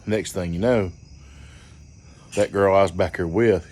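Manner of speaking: normal talking voice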